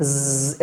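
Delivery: imitated insect buzzing, a sustained zzz; bee buzz. Teacher imitates big bees near the ear, buzzing. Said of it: "এরকম একটা আওয়াজ চলে আসে".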